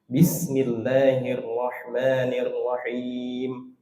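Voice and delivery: Bismillahirrahmanirrahim with a clear b at the start, slow. Shown as a demonstration. Teacher shows the correct reading with every letter. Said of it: "dibaca jelas hurufnya".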